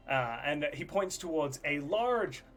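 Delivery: normal talking voice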